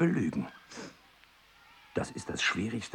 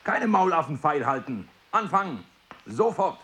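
loud, high, harsh classroom orders; barking teacher; 11 semitones higher, 6 dB louder than usual. Acted. Strict teacher yelling at his class to begin. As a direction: drive the pupils to start at once.

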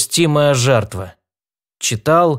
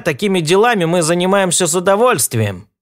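bright, higher cheerful spider; cheerful little creature voice; 7 semitones higher than usual. Acted. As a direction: play a cheerful tiny helper. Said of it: "весело добавил другой паук".

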